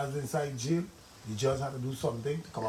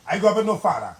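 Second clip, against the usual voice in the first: loud emphatic; 5 semitones higher, 8 dB louder, faster than usual. Real, not acted. Pleading about absent fathers.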